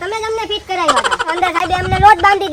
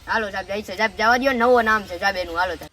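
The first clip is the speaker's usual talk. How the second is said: lower, softer wheedling plea; hushed pleading; 8 semitones lower, 7 dB quieter than usual. Acted. Friend hushes his voice begging the officer, soft and low. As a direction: coax the officer quietly.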